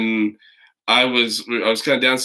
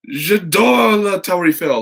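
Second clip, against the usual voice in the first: mock French; 5 semitones higher, 3 dB louder, slower than usual. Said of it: "all I could say is".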